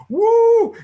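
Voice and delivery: loud high whoop; excited woo. Retold or imitated. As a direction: cheer like you found treasure. Cheering at the old corner.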